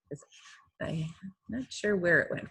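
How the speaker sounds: normal talking voice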